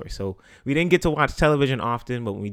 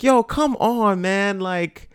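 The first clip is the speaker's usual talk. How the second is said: put-on whiny kid plea; 7 semitones higher than usual. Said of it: "And I'm like".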